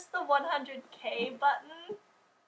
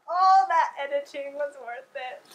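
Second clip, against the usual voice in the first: normal talking voice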